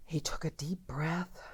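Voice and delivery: whispered, holding the breath; hushed suspense. A narrator's reading. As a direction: draw the listeners in before the big crow. Narrator builds suspense before crow.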